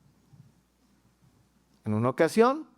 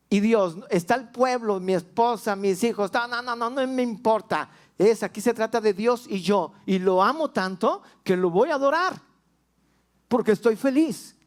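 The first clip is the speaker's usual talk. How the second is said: joyful devotion; 6 semitones higher than usual. Pretend. David declaring his love for God before the people, fervent. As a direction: declare it with passionate joy. Voicing David's inner resolve.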